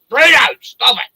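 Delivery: loud, high shout at the dog; yelling at the dog. Real, not acted. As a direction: bark the order across the yard. Yelling at dog chasing possum.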